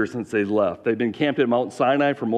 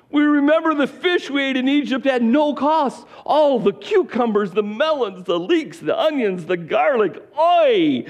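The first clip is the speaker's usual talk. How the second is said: high, slow, whining wail; long drawn-out whiny moan; wailing Israelites; 13 semitones higher than usual.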